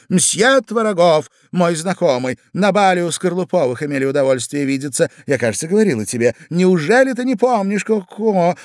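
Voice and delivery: high, lively feminine voice; gushing society wife. Acted. Wife introduces her admirer to husband, gushing. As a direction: introduce him brightly, quickening midway.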